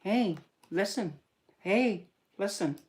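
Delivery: slow, high, attention-seeking fairy call; drawn-out sprite voice impression; fairy from Zelda. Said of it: "being like that fairy from Zelda that's saying".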